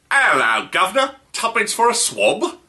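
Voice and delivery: hammed-up Cockney street urchin begging pennies; Cockney chimney sweep. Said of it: "The Cockney chimney sweep".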